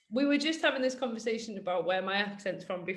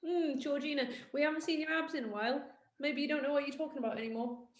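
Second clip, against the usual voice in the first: higher, quick, needling voice; imitating a sceptical follower; mock doubting follower; 5 semitones higher, faster than usual. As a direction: gently chide her in a raised, put-on voice. Mimicking doubting followers about abs.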